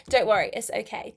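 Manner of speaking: usual speaking voice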